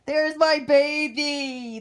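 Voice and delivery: loud, high, drawn-out adoring squeal; gushing delight. Real, not acted. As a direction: coo loudly over your darling. Gushes on seeing favourite doll.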